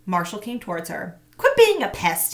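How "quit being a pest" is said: put-on irritated boy. A narrator's reading. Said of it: "he said".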